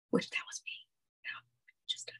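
unvoiced hush to the listener; whispered aside. Real, not acted. Patient tells a friend in a whispered aside. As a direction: let it slip out under your breath.

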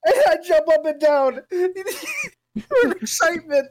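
high, drawn-out excited squeal through laughter; hammed-up excitement. Redoing the joke line for friends, hammed-up excitement. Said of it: "I wanna do it more".

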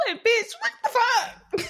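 laughing outrage. Real, not acted.

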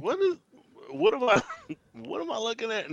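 high, incredulous what-am-I-looking-at; baffled kid. Retold or imitated. Kid reacting to bad accents.

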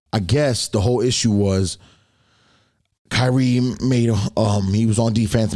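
ordinary talk, nothing marked

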